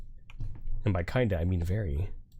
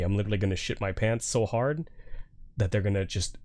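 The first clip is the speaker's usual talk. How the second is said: ordinary talk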